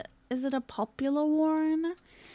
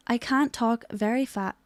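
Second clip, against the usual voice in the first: unexcited off-stream voice. Shown as a demonstration. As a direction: hold the excitement back. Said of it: "If I was to talk like this".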